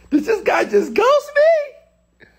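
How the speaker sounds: very high, slow falsetto re-ask; mock high voice. Re-asking the ghosting question in a high, slow falsetto.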